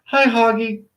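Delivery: ordinary talk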